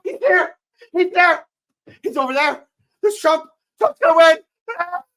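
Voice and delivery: shrieking, high, loud hysterical panic; liberal panic. Acted. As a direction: scream in terror as a panicking liberal. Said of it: "will now reenact a full-on liberal panic".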